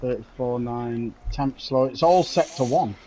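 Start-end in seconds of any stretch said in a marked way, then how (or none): none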